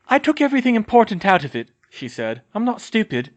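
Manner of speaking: high, quick defiant retort; defiant young woman. Acted. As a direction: snap back defiantly. Hermione defiant under capture.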